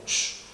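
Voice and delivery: "shh" of a fizzy drink; imitated hiss. Showing someone having a Coca-Cola, imitated hiss. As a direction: imitate a soda hissing open, no voice. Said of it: "Hace así".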